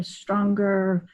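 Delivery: ordinary talk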